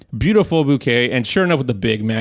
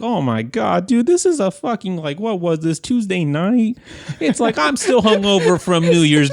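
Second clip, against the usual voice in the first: much higher, gushing disbelief; excited rave; 8 semitones higher than usual. Retold or imitated. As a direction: rave about it breathlessly. Raving about wine's smell.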